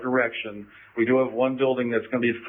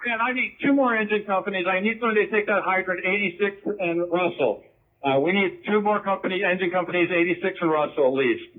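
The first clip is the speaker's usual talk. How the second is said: urgent firefighter radio; 7 semitones higher than usual. Real, not acted. Fire officer calling for engines.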